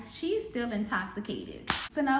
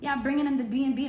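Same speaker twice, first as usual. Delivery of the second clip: fast, higher-pitched angry demand; rapid-fire indignant question, voice raised; retold mad outburst; 5 semitones higher, 4 dB louder, faster than usual. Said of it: "I was mad".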